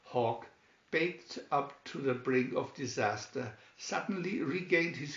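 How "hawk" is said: ordinary delivery, nothing marked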